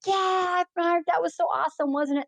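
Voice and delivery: high-pitched, enthusiastic imitation; excited reminiscing. Rider reminiscing with caregiver about the ride, imitated excitement. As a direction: act out a delighted rider recalling the flight. Acts out delighted shared memory.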